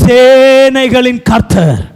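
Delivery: "சேனைகளின்" stretched out long and strong; grand, drawn-out pulpit delivery of "சேனைகளின் கர்த்தர்"; proclamation. Acted. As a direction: proclaim the title slowly, with weight. Proclaims the divine title.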